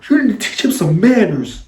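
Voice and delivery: high-pitched mock scolding; scolding babysitter. Babysitter tells boys to teach him manners, high mock scolding. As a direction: imitate an indignant sitter, pitched well up.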